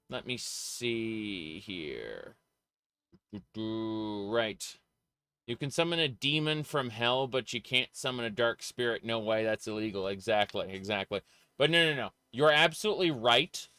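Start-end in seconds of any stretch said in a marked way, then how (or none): none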